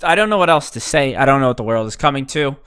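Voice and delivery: ordinary talk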